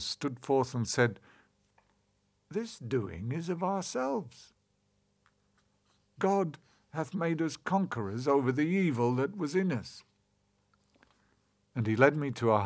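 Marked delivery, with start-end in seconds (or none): 2.5-10.0 put-on a grave old elder answering gently; solemn elder. A narrator's reading.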